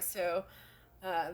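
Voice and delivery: normal talking voice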